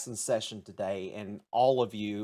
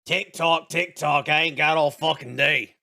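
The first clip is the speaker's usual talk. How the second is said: louder, higher, impatient teacher voice; 5 semitones higher, 7 dB louder than usual. Acted. Grumpy teacher hurrying the class, loud and impatient.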